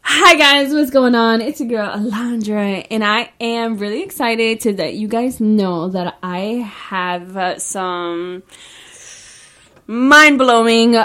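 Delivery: loud, bright influencer greeting; Instagram intro voice. Pretend. Vlogger starting her Instagram announcement, upbeat and loud. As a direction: hype it up like a video intro. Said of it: "as if I was, like, taking a video for it".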